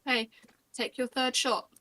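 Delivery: ordinary talk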